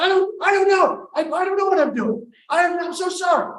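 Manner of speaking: high, loud, flustered apology; panicked stammering. Acted. Panicking man apologizes to his date, high loud stammer.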